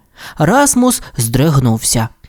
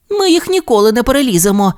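high, quick little voice; mild childlike discouragement; sighing dismay; 9 semitones higher, faster than usual. Acted. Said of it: "зітхнув Тімі".

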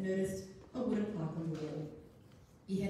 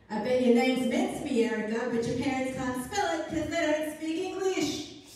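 mocking schoolyard taunt, loud and high; 7 semitones higher, 9 dB louder than usual. A narrator's reading. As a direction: sneer it out loud at her. Bully taunting in playground.

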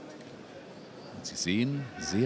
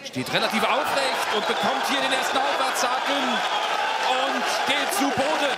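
much higher, loud excited call; excited commentary; 14 semitones higher, 6 dB louder than usual. Real, not acted. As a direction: shout it as the knockdown lands. Commentator sees first knockdown. (aufgeregter Reporterschrei)

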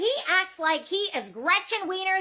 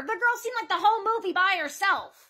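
usual speaking voice

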